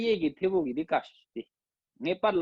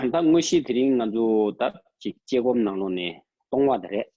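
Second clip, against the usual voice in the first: ordinary talk, nothing marked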